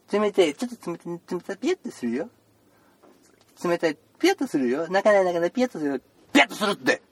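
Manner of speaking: put-on doctor warning boy it will feel cold; warning a child. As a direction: prepare the kid for a cold touch.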